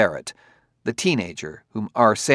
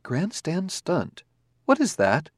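higher, even, polite robot; calm robot voice; 8 semitones higher, slower than usual. Acted. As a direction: speak as a courteous, precise android. Robot character held voice.

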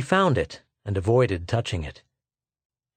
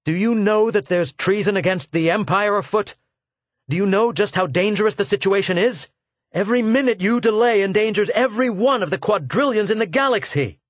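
much higher, louder alarmed shout; urgent shouting; 9 semitones higher, 4 dB louder than usual. Acted. Arviden yelling at Claudy about treason, urgent. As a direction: shout the danger at him.

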